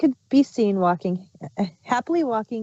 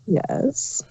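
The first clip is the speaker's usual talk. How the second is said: whisper; 2 semitones higher, 3 dB quieter than usual. Acted. Caught queen answers.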